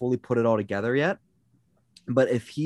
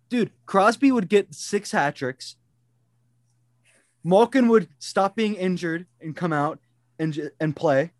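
excited; 7 semitones higher than usual. Real, not acted. Gushing over dream coach.

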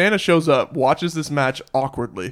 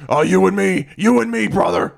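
Hulk Hogan voice; 5 semitones higher than usual. Retold or imitated. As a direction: hype it like a wrestling promo. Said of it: "Hulk Hogan basically just jacks him off".

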